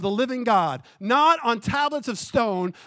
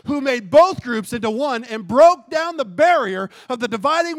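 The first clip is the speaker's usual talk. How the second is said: fervent scripture reading; 4 semitones higher, 4 dB louder than usual. Acted. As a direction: build the verses to a shout. Building scripture reading to climax.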